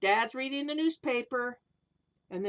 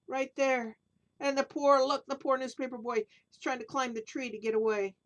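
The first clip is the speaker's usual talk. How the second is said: plain reading voice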